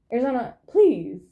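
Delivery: firm call to the cat; sharp command to the pet; scolding pet. Real, not acted. Telling the cat off.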